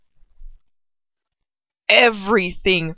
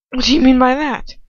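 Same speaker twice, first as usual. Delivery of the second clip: sharp demand; 4 semitones higher, 9 dB louder than usual. A narrator's reading. Lucius demands from Ron.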